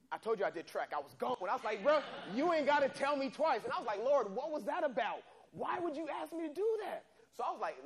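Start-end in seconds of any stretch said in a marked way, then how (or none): 1.8-3.6 high and loud, running away; hyped, fleeing. Retold or imitated. Teen bolting away after the stranger says get out. 4.0-7.1 exasperated. Retold or imitated. Exasperated prayer while running.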